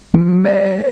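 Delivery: high pitiful sheep bleat; plaintive bleat. A narrator's reading. Lost lamb answering from afar, pitiful bleat. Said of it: "ein jammervolles".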